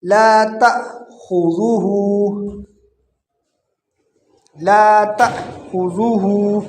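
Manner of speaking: slow لا تأخذہ, stop sharply after تأ; separated ta hamza. Shown as a demonstration. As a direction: break at the hamza before خذہ. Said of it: "الف کے ساتھ ہے". (تأ پر ہمزہ کا جھٹکا)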